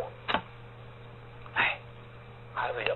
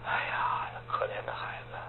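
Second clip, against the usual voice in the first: whispered sigh, much lower; pitying whisper; 10 dB quieter than usual. Acted. Narrator sighs over the poor orphan boy, whispered. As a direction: breathe out pity for the poor child.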